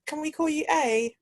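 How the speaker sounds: high-pitched mock polite request; people asking. People avoiding a foreign name, mock high-pitched asking. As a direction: imitate someone asking, pitched well up. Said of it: "They'll be like".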